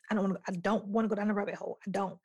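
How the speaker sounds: normal talking voice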